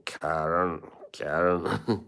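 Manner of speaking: Karen said with a Scottish lilt, rolled r; Scottish accent. Retold or imitated. Imitating the Scottish host saying Karen in a Scottish accent. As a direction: echo Craig Ferguson saying Karen in Scottish. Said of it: "the way he pronounced her name".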